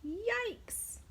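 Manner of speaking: very high, sudden yikes; startled yelp. Acted. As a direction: jump with fright. Pete startled by whale.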